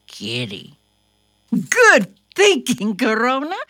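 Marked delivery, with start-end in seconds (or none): 1.5-3.6 praising. Real, not acted. Praising the puppet.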